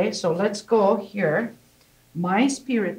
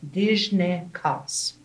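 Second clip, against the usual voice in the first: slow model of Dijnikas; 3 dB quieter, slower than usual. Shown as a demonstration. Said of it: "you put Dijnikas".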